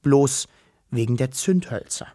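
usual speaking voice

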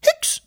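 ein sehr hoher einzelner Hickser; Schluckauf. A narrator's reading. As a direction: ein Hickser, ganz hoch gequietscht. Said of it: "machte Wischel". (sehr hoher Hickser)

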